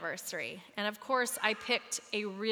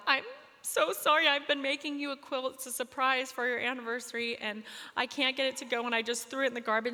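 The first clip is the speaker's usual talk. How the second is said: retold teary burble; 5 semitones higher than usual. Calling her mom from a payphone, teary blurted apology. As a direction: blurt out sorry through tears. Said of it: "burbled out".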